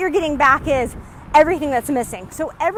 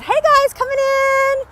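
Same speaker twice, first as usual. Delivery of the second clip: higher, louder hello to arrivals; excited greeting; 11 semitones higher, 6 dB louder, slower than usual. Real, not acted. Host welcoming new viewers into the live, excited. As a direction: welcome them in brightly.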